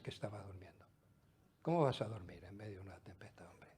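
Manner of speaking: a quick, hushed aside; whisper. Real, not acted. Preacher confides to the congregation, a fast whisper.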